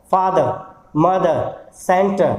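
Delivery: फादर, मदर, सेंटर each ending in a schwa; silent final R. Shown as a demonstration. Teacher lists examples for learners, each final R silent. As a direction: list फादर, मदर, सेंटर separately, dropping every final R. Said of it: "उसमें आर नहीं है".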